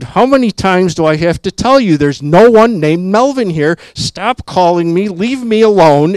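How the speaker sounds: high, loud mock scream of an angry woman. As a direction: belt it out like a fed-up woman at the phone. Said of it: "The woman screamed".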